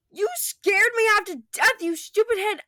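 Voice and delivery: high, loud shouting protest, slowing at the end; loud tearful protest. Acted. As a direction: shout at him, relieved and furious. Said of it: "Nora protested".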